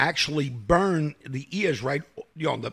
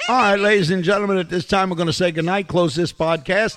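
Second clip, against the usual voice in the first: louder announcer voice, slowing at the end; podcast sign-off; 4 semitones higher, 8 dB louder than usual. Acted. Host closing the podcast for listeners, announcer sign-off. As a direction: sign off the show like an emcee.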